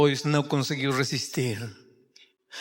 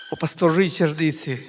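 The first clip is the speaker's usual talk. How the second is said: usual speaking voice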